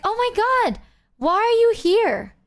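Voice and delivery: Zeta shrieks in surprise seeing the streamer, then slows; surprised. Retold or imitated. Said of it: "she was like".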